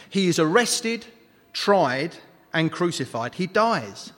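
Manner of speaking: usual speaking voice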